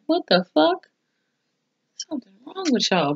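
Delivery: shocked disbelief. Retold or imitated. Viewer recapping a show reacts to creepy ghosts, in high shocked disbelief. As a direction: squeal it out in disbelief.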